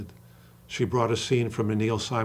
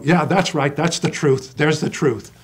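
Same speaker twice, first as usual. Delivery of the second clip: louder, higher cry of recognition from the crowd; audience agreeing; 4 semitones higher, 6 dB louder than usual. Retold or imitated. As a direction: call it out like a moved audience member. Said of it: "and they're gonna go".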